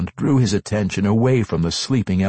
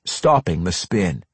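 ordinary delivery

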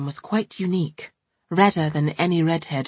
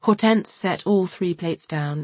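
plain reading voice

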